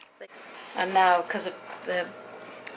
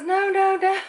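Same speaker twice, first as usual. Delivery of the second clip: high, louder no no no; eager reassurance; 12 semitones higher, 5 dB louder than usual. Retold or imitated. Caller insisting the yearbook was great, high eager denial. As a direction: insist warmly, rising high. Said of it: "and she goes".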